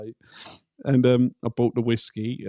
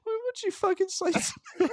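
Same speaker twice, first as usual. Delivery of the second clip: high mock outrage; 5 dB quieter, faster than usual. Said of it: "You're in her country".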